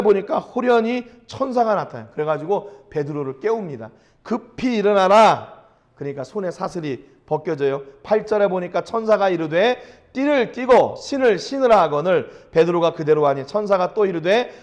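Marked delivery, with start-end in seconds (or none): 4.2-5.6 loud, slow command to rise; angel's command. Acted. Angel wakes Peter in prison. 10.1-11.8 raised, higher commanding voice; angel's command. Acted. Angel commands Peter in scripture.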